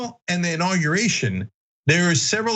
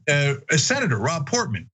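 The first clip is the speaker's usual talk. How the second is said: ordinary talk, nothing marked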